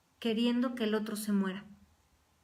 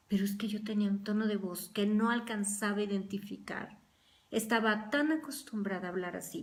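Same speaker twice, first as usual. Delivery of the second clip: ordinary talk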